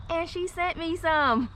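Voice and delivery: high, slow, delighted emphasis; excited. Real, not acted. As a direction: savor the good news. Excited reveal of gifted fabric.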